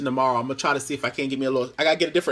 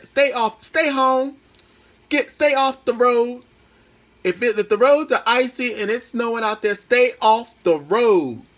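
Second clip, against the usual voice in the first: higher, louder, slow pleading warning to stay off the road; urgent warning; 9 semitones higher, 6 dB louder than usual. Real, not acted. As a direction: hammer home each "stay" like a worried mother. Warning viewers about icy roads.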